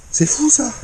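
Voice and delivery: high astonished remark; amazed. Real, not acted. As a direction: say it with real amazement. Fish same size as record.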